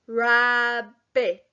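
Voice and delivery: rabbit sounded out in two beats; syllable by syllable. Shown as a demonstration. Teacher leads class sounding out rabbit. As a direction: say rabbit slowly in two claps. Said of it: "nice and slow".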